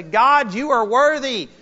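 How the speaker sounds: loud, high cry of praise, as the saints would exult; rejoicing praise. Acted. Saints and angels praising God in the sermon, jubilant loud cry. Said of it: "the saints and angels rejoice and say".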